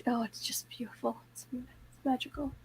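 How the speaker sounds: soft, dreamy sigh. Real, not acted. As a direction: savour the memory softly. Dreamy massage memory.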